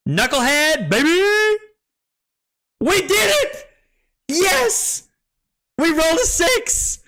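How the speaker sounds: high, loud screaming celebration; real triumphant yelling. Real, not acted. Streamer celebrates rolling a six, ecstatic high shouting. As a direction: yell it out like a jackpot win.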